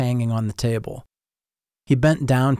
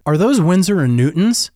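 higher and louder, bursting in excitedly; eager interruption; 5 semitones higher, 6 dB louder than usual. A narrator's reading. Boy interrupting his art teacher, eager and louder. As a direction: cut him off in eager excitement. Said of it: "I interrupted".

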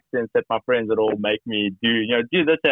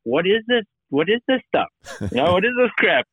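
higher, incredulous imitating; mock disgust; 8 semitones higher than usual. South Africans tasting American jerky, higher mock disbelief. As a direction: mimic baffled immigrants tasting jerky. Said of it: "They're like".

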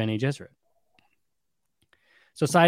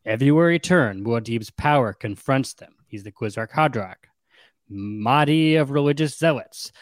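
ordinary talk, nothing marked

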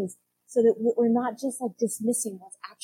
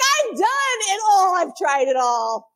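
exasperated protest; 8 semitones higher, 8 dB louder, slower than usual. Retold or imitated. Voiced weary exasperated reply.

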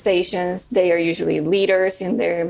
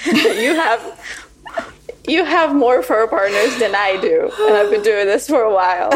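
laughing coach; 3 semitones higher, 3 dB louder than usual. Retold or imitated. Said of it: "she's like".

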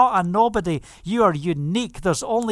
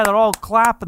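normal talking voice

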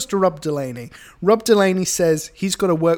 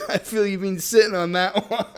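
laughing tease; 4 semitones higher than usual. Real, not acted. Teasing co-host while laughing.

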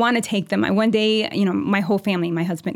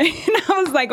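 ordinary talk, nothing marked

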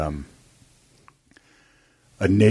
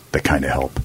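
hushed dramatic voiceover; movie trailer voice; 6 semitones lower, 4 dB quieter than usual. Pretend. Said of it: "that's the movie trailer voice".

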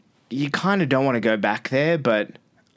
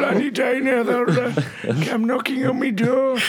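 mock gruff Scottish voice; 11 semitones higher than usual. Joking about being fired.

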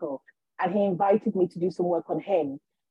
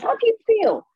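very high, loud eager question; overjoyed vicar; 14 semitones higher, 5 dB louder than usual. Retold or imitated. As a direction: ask like a thrilled vicar. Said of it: "so happy for me".

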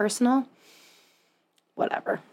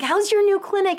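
much higher bright question; hammed-up friendly small talk; mock chirpy acquaintance; 11 semitones higher than usual. Acquaintance asks, retold.

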